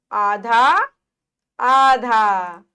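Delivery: आधा stretched slowly; teacher stretching आधा; slow model word. Shown as a demonstration. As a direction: draw out आधा for the class.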